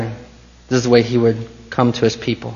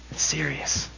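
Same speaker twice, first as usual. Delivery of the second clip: grave hush; 2 semitones higher, 14 dB quieter than usual. Real, not acted. Solemn hushed emphasis.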